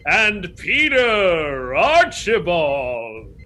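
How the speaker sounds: booming, drawn-out ring announcer. Shown as a demonstration. Cohost thanking Patreon supporters by name, big announcer voice. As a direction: stretch each name out loud and high, then let it fall. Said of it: "in my announcer voice".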